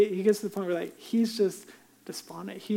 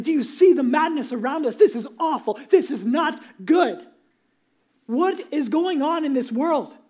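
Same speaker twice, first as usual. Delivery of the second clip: mock despair; 6 semitones higher, 7 dB louder than usual. Mock despair of losing side.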